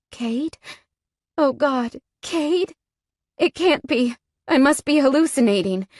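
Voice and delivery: can't trust what she sees; dazed disbelief. A narrator's reading. Wounded woman sees her rescuer, stunned disbelief.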